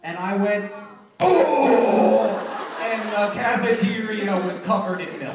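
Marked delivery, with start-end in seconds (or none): 1.2-2.4 surprised. Retold or imitated. Surprised reaction to milk spill.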